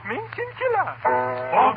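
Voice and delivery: normal talking voice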